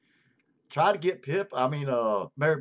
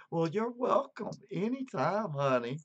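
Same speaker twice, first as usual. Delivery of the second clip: sweet assistant voice, pitched up; Alexa voice; 5 semitones higher than usual. Retold or imitated. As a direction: play the polite smart speaker. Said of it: "Sometimes she".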